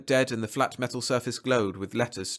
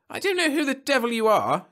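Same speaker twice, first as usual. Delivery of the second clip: very high, loud angry shout; 5 dB louder than usual. A narrator's reading. Angry client confronting an unknown supervisor, shouting. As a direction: shout it indignantly. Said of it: "shouted the man".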